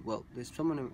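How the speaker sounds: normal talking voice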